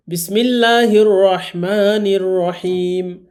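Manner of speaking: slow, chanted Arabic recitation; Quran recitation. Acted. Teacher opens the Quran class, slow chanted recitation. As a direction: intone it slowly like a reciter.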